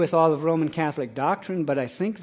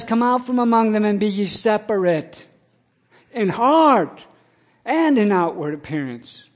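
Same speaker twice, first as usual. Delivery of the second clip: raised, loud preaching voice; 7 semitones higher, 7 dB louder than usual. Acted. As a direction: press the verse on the listeners. Preaching the verse to listeners.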